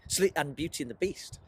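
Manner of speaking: ordinary talk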